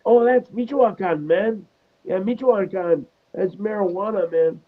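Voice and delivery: high-pitched comic stoner voice; Cheech. Retold or imitated. Quoting Cheech identifying the leaf in class, high stoner voice. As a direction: put on a high, easygoing stoner lilt. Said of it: "And then Cheech is like".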